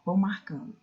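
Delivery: ordinary talk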